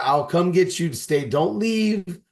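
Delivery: ordinary delivery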